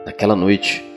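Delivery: plain reading voice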